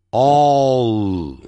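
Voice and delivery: 哦 drawn out into a dark L; long, held "all" sound on 哦; final L demo. Shown as a demonstration. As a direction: end 哦 with the tongue tip curled up. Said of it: "舌尖一定要呢翘起来".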